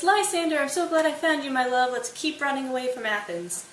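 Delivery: high, loud gushing relief; hammed-up happy reunion; overjoyed lover. Hermia overjoyed to find her love.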